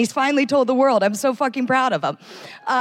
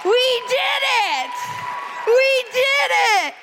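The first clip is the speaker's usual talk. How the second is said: excited shout; 10 semitones higher, slower than usual. Real, not acted. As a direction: burst out in triumph. Comic bursts on stage.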